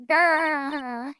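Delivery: playful mock growl; tiger growl. Tiger lurking in circus.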